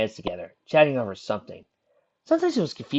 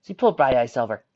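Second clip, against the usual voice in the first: plain reading voice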